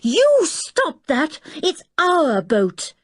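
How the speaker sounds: high, slow, shouted at the men; yelling. A narrator's reading. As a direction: yell it out to stop the thieves, voice up high. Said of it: "She yelled at the top of her voice".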